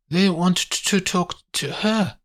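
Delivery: high, hesitant Italian woman; flustered Italian maid. Acted. Maria tells Paddy whom the police want, flustered. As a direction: stammer out the message, pitched right up.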